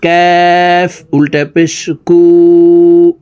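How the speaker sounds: কু with the tongue a bit forward of the root; কাফ articulated just in front of qaf; kaf sound. Shown as a demonstration. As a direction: move the tongue slightly ahead for কাফ. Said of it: "জিহ্বার গোড়া থেকে একটু আগে বাড়াইয়া".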